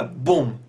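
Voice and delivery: "بوم" as a punchy beatbox kick drum. Shown as a demonstration. Teacher showing students a beatbox kick, lips popped hard. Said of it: "یه حرف دیگه بیاد".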